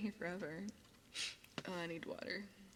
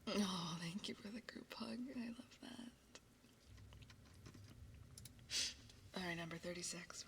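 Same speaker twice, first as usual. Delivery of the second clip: whispering; 7 dB quieter than usual. Acted. ASMR whisper.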